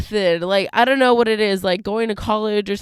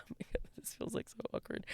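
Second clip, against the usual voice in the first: whisper; 22 dB quieter than usual. Real, not acted. Embarrassed aside, whispered.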